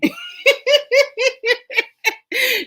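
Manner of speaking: high, loud cackling laugh; big laugh. Real, not acted. Host cracking up at her own joke, real loud laughter. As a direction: burst out laughing, real and unrestrained.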